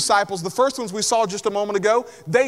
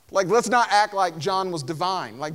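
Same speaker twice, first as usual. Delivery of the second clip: ordinary talk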